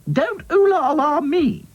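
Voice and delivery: high, slow, indignant doll voice; high cross doll. Acted. Dotty scolding the French doll, high and cross. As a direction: snap it back, high and offended.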